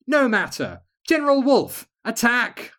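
high, loud battle order; commanding shout. Acted. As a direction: yell the order to attack. Second attack order.

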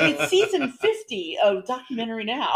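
mock Mirren introduction; Helen Mirren intro. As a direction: introduce it like a stately host. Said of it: "She's like".

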